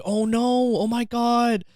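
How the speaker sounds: high, distressed mock gasp of worry; hammed-up dismay; alarmed concern. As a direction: play a fretful, over-sympathetic girlfriend. Imagined girlfriend being sympathetic.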